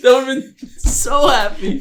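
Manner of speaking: high, drawn-out words through laughter; laughing hard. Real, not acted. Fan delighted by their own portal idea, high laughing squeal. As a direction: squeal it out slowly while cracking up.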